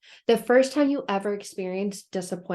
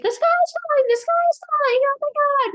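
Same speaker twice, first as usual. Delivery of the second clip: high, loud frantic squeal; panicked Chicken Little; 7 dB louder, faster than usual. Retold or imitated. Chicken Little warning everyone the sky falls, high panicked squeal. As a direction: shriek it like a terrified little chicken. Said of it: "If you remember the catchphrase".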